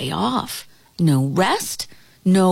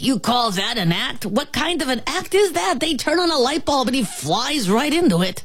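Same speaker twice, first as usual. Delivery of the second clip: higher-pitched ant disbelief; scornful outcry; 6 semitones higher than usual. A narrator's reading. Ant mocking the moth's act, scornful outcry. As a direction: climb into scoffing incredulity. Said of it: "cried the ant".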